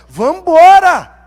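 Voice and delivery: loud, drawn-out slow cry; preacher rallying the congregation, slow loud shout. Acted. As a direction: rouse the whole church with it. Rallying call to church.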